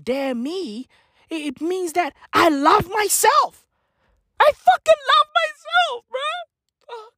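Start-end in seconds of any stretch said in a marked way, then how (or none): 4.4-7.1 mock crying. Mock emotional cry.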